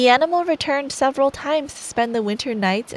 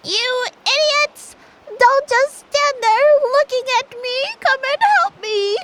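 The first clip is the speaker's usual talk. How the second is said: a tiny cranky dwarf screaming for help; angry dwarf voice; 5 dB louder than usual. Acted. Trapped dwarf yelling at the two girls, high and furious. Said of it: "when I do the dwarf voice".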